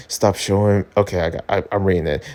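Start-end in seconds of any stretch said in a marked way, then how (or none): none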